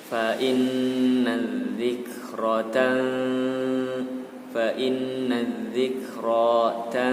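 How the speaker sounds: slow chant, doubled dz in "inna dzikratan"; doubled zal. Shown as a demonstration. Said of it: "Zalnya juga bertasdid, maka didobel".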